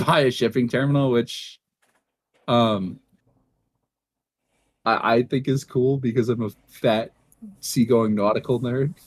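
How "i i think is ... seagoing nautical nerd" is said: ordinary delivery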